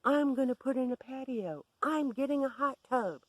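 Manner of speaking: high, loud imitated ranting; hyperventilating screaming. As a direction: shout it out in mimicked frenzy.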